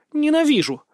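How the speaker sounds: put-on high-pitched girlish huff; sulky outburst. A narrator's reading. Tearful sister to the brother she missed, sulky. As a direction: throw it out high and petulant. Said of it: "буркнула она".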